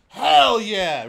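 loud shout of hype; loud pumped cheer. Real, not acted. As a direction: pump yourself up. Hyping Karate Kid mode.

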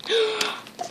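sharp gasp of delight; high, delighted intake of breath; excited gasp. Real, not acted. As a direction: gasp in surprise at a lovely find. Opening a blind bag, delighted.

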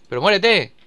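ordinary delivery

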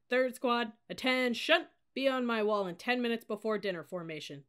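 controlled but commanding cadet officer; terrifyingly calm command. A narrator's reading. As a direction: give crisp orders to a squad. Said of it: "spoke with terrifying calmness".

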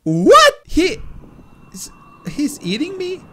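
high, loud, stammered question; incredulous high-pitched outburst; shocked disbelief. Real, not acted. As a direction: squeal it in astonishment. Shocked at being eaten.

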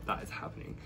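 low breathy whisper. Real, not acted. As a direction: breathe it like a secret. Whispered confirmation.

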